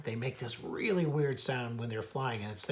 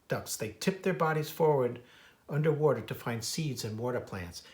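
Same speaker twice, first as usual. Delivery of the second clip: plain reading voice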